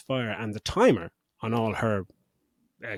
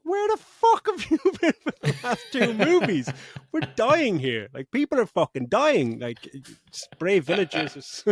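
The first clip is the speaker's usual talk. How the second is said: much higher, louder exasperated rant with laughs; mock rant; 8 semitones higher, 3 dB louder than usual. Podcaster mocking robot Jimmy for arriving late, exasperated rant.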